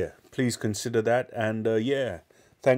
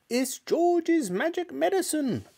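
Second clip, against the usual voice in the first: put-on high, slow, crowing old woman's voice; shouting old grandma; slower than usual. Grandma shouting from the rooftop, high and crowing. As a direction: crow the words out proudly like an old witch. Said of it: "Grandma shouted".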